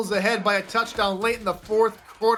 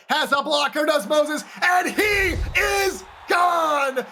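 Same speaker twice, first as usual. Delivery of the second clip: touchdown call; 9 semitones higher than usual. Acted. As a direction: roar it out as he breaks free. Touchdown run called live.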